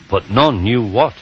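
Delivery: plain reading voice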